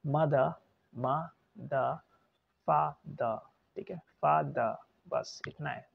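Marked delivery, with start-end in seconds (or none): 0.1-3.4 "mother" as MA ... duh, "father" as FAA ... duh; syllable by syllable, pausing, endings on uh; slow schwa demo. Shown as a demonstration. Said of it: "फिर से देख लीजिए slow करके". 4.0-4.8 "father" as FAA ... duh; schwa ending. Shown as a demonstration. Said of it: "slow करके".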